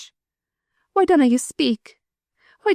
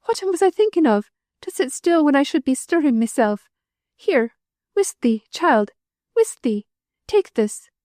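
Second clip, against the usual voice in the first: frantic grief; 8 semitones higher than usual. A narrator's reading. Widow hushing her child while her heart breaks, frantic. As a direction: come apart with grief.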